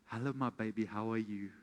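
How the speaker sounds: low, soft, crooning hello; sweet-talking husband. Retold or imitated. Husband sweet-talks his tired wife at night, low and crooning. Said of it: "I go to her and say".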